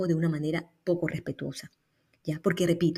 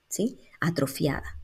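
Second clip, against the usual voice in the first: usual speaking voice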